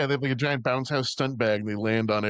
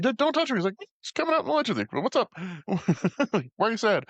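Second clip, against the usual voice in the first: lightly voiced, pitched much higher and teasing; mock rooftop chat; 9 semitones higher, faster than usual. Cop chatting up the jumper on the ledge, mock high voice. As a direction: play it sing-song high and mocking.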